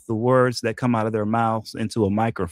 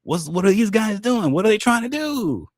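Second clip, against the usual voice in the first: much higher, alarmed onlooker voice; mock bystander alarm; 8 semitones higher than usual. Onlooker watching dog chase car, high alarmed mock voice. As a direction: gawk at the dog in high disbelief. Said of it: "It's like".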